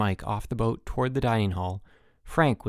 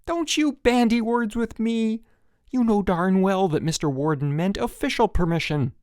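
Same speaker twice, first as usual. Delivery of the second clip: angry scolding; 10 semitones higher, 4 dB louder than usual. A narrator's reading. Counselor scolding camper aloud. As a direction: scold him hard, no more whispering. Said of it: "Chip was no longer whispering".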